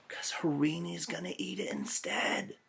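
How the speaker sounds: playful whispered aside. Real, not acted. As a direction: lean in and whisper it to the class. Aside to the class.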